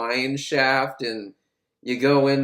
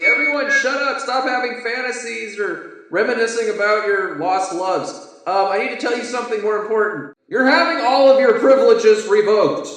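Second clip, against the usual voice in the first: higher, louder mock announcement; warden on intercom; 6 semitones higher, 5 dB louder than usual. Warden addressing prisoners over the intercom, mock stern announcement. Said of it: "He's like".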